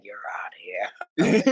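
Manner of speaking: low, slow, whispery verdict; low dismissal. Retold or imitated. Teller throws out the bleeding kid, low whispery dismissal. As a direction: say it slowly, low and final.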